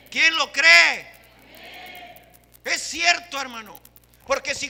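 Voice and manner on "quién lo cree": ¿Quién lo cree? shouted high and slow; fervent shout. Real, not acted. Preacher repeats, stronger.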